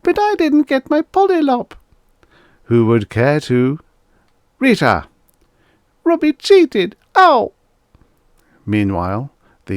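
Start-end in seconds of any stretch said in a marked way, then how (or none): 0.0-1.7 put-on very high, louder child's whine; little girl. Little girl whining to mother. 2.6-3.8 higher, louder pushy sales patter; robot salesman pitch. Acted. 4.6-5.1 loud sharp call of a name. Acted. Mother calling the child. 6.0-7.5 put-on very high, loud childish yelp; child crying out. Little girl crying out.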